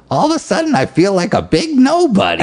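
very high-pitched cartoon housewife impression; Marge Simpson. Quoting a cartoon mom overwhelmed by New York, high cartoon impression. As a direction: put on a squeaky, strained cartoon wife voice. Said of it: "Marge Simpson ... she goes".

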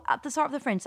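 ordinary talk

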